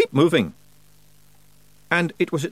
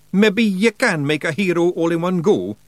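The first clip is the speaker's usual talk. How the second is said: higher musing Scottish brogue; thoughtful Feegle; 7 semitones higher, 4 dB louder than usual. A narrator's reading. Rob muses about making a hero, higher Scottish brogue. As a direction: wee Feegle pondering aloud. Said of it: "said Rob Anybody thoughtfully".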